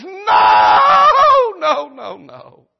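long, very high scream on the first no, then falling away; blood-curdling scream. Acted. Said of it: "screams and says".

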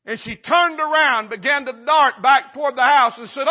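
high, loud, urgent storytelling; preaching at full force. A narrator's reading. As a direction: drive the fire story home at full volume.

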